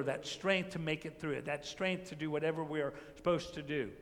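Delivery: usual speaking voice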